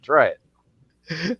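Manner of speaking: ordinary talk, nothing marked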